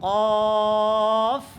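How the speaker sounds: long rounded "কফ" with a thick vowel; thick, drawn-out model "কফ"; heavy qaf. Shown as a demonstration. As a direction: hold "কফ" heavy, ending on f. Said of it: "এটা মোটা".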